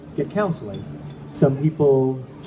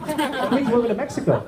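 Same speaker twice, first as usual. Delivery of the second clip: excited; 8 semitones higher than usual. Retold or imitated. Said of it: "I'm like".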